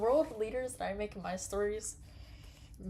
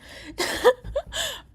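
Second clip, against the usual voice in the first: high laugh; 6 dB louder than usual. Real, not acted. Laughing at own attempt.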